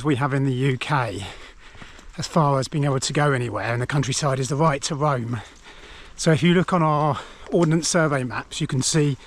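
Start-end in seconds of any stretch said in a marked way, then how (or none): none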